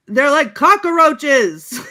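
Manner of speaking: slow, high, loud yell of disgust; mock yell. Ron Perlman quoted yelling at zombie monks, loud slow yell. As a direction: bellow it out like a gruff warrior. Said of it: "Ron Perlman yells".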